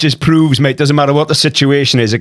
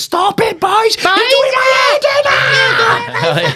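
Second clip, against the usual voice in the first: hammed-up mum scolding the boys; shrieking Welsh mum; slower than usual. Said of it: "sound clips at the beginning of the podcast where it goes".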